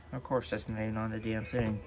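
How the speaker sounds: ordinary delivery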